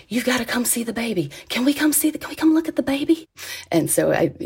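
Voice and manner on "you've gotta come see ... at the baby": put-on pitched higher and higher, eager to see the baby; a jolly stranger calling his wife over, more and more thrilled; excited Southern man calling out. Jolly man calling to his wife Rhonda, excitedly high. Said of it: "calling out to his wife".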